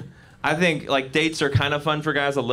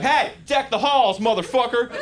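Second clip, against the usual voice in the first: mad carolling; 7 semitones higher than usual. Retold or imitated. Angry Southerner forcing a happy carol on him, mock shout. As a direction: bark festive words like a threat. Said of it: "why are you mad at me?".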